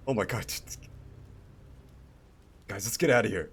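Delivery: high, loud scared cry; panicked goblin. Acted. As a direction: flee in a panic. Fleeing goblins voiced.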